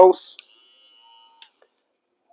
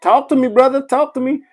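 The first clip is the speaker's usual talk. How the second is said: excited greeting; 7 semitones higher than usual. Real, not acted. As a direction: hype up a regular who just showed up. Greeting a viewer joining live.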